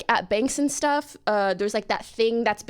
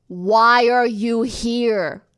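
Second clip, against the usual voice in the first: slow agent question; 6 dB louder, much slower than usual. Retold or imitated. Agent voiced in story.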